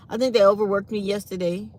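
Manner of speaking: usual speaking voice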